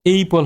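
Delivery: "এই পল" opening with a long "ay" glide; wrong long vowel. Shown as a demonstration. Teacher showing apple with one p, mispronounced with long a. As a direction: say "এই পল", first syllable like the letter A. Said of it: "আমাদের উচ্চারণ এই পল না".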